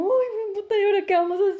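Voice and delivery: high, loud, frantic mock panic; hammed-up panic, as if lost at nightfall; panicked cry. Retelling the heroine lost in the forest, loud hammed-up panic.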